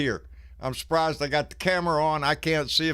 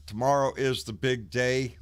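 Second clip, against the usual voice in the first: ordinary talk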